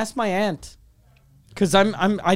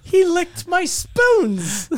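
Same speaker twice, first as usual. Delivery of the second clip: mock outrage; 10 semitones higher, much slower than usual. Joking about the Greek guy.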